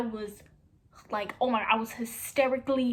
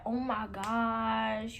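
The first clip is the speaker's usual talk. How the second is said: slow, stretched out, a little softer; drawn-out oh my gosh; 3 dB quieter, much slower than usual. Retold or imitated. Telling a friend her reaction, slow amazed drawl. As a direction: let it hang in slow amazement.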